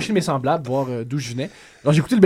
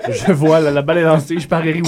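ordinary talk, nothing marked

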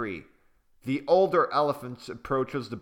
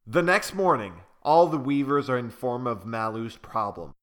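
plain reading voice

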